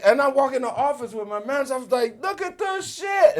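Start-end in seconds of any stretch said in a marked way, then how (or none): 2.2-3.4 high, slow, stretched-out show-off voice. Retold or imitated. Walking into [name]'s office with his manager, high slow bragging voice. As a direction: drawl it out high like a cocky newcomer. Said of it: "and I'm talking like that".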